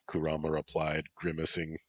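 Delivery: ordinary delivery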